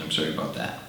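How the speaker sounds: normal talking voice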